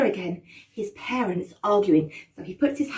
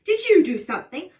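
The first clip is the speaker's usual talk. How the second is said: higher, louder accusing question; suspicious Hansel; 7 semitones higher, 6 dB louder than usual. Acted. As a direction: press her, suspicious. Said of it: "Hansel repeats accusation".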